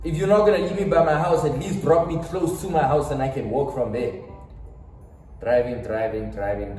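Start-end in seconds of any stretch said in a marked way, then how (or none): 0.0-4.1 loud, exasperated complaint; raised voice. Retold or imitated. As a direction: insist loudly, fed up. His raised complaint to driver.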